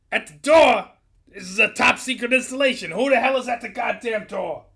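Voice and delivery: high, loud, outraged yelling; irate distant shouting. A narrator's reading. Boss yelling at guard about the visitor, irate. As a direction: yell back in disbelief and anger. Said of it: "exchange across some distance".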